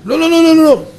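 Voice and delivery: high, loud cries of no; horrified no. Retold or imitated. Shocked cry of no at the matzot offer. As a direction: recoil in horror, shouting no.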